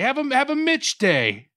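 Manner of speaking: high, slow mocking slogan. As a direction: drag out the parody slogan.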